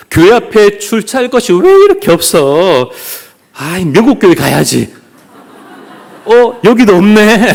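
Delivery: high-pitched, exasperated grumble; mock complaint. Churchgoer hunting for parking, playfully exasperated. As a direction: act out mounting parking frustration. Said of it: "이렇게 불평하지 않습니까".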